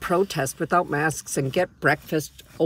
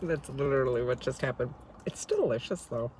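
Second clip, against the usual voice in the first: speaking while holding food; talking after a bite. Real, not acted. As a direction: bite during speech. Seen 1: Holds bitten fried snack, brings it to mouth mid-phrase, grins.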